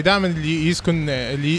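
normal talking voice

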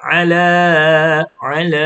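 "อะ ลา" from mid-throat, long final vowel; throat ain sound. Shown as a demonstration. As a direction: squeeze "อะ" in the middle of the throat, then hold "ลา". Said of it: "ตัว อีน ลำคอ ส่วนกลาง".

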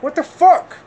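high, loud exasperated cry; outraged disbelief. Retold or imitated. Venting to camera about girlfriend, loud high outrage. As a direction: let the outrage spike up high.

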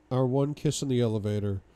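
usual speaking voice

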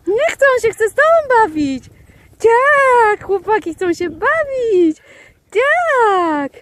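high, excited, drawn-out praise; gushing dog talk. Real, not acted. Rozczula się nad bawiącymi się psami. (wysoki, rozentuzjazmowany głos do psów)

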